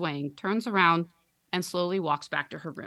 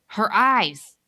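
strangled cry; 4 semitones higher, 9 dB louder than usual. Acted. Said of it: "in a strangled voice, whispers".

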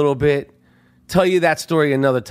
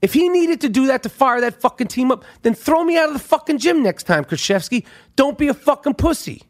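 raised pitch, angry, daring tone; heated rant; 7 semitones higher than usual. Real, not acted. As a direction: throw the challenge at the coach. Rant at Krzyzewski, daring him.

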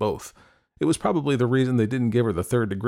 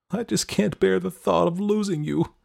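high-pitched, tearful plea; holding back tears; 11 semitones higher than usual. A narrator's reading. Girl afraid of losing her boyfriend, holding back tears. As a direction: fight back tears while pleading high. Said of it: "holding back the tears".

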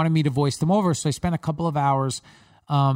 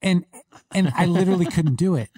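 normal talking voice

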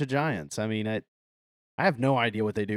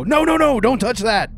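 urgent warning shout; 8 semitones higher, 6 dB louder than usual. Acted. Droth warns ogre off bomb.

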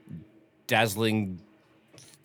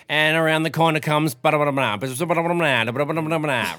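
rapid-fire, higher, louder horse race call; nasal race commentator; 6 semitones higher, 7 dB louder, faster than usual. Retold or imitated. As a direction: rattle it off like an auctioneer calling the race. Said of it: "some really nasally commentator being like".

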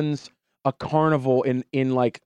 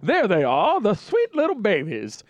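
put-on old gentleman voice; 7 semitones higher than usual. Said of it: "every kid that walks up".

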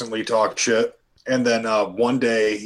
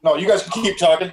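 normal talking voice

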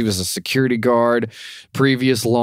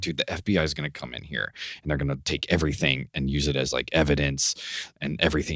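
hushed warning; 7 semitones lower, 9 dB quieter, faster than usual. Retold or imitated. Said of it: "He's like".

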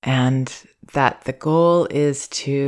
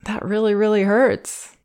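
higher, feeling the hurt with him; empathizing with baby; 4 semitones higher than usual. Shown as a demonstration. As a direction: acknowledge the pain, really seeing him. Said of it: "to really see".

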